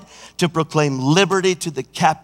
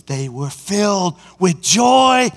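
joyful shout; 5 semitones higher, 6 dB louder, slower than usual. Acted. Reading scripture with a shout.

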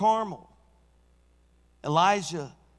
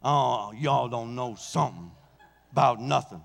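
T D Jakes; 6 semitones lower than usual. Retold or imitated. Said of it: "I felt like T D Jakes".

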